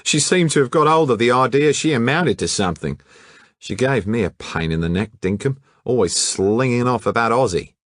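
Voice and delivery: a cocky colonial lad griping; brash young Australian. Acted. Hatchett complaining about the model to detectives, brash Aussie twang.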